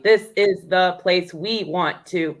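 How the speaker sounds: "This is the place we want to" slow, one beat each; syllable by syllable. Shown as a demonstration. Student checking the haiku count, "This is the place we want to" syllable by syllable. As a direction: tick off "This is the place we want to" slowly.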